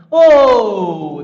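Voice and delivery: long loud "ohh", falling in pitch. Real, not acted. Teacher exclaiming to the class mid-problem, loud drawn-out "oh". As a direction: draw out a loud, sliding "oh". Exclaims while working out the answer.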